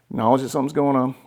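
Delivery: ordinary talk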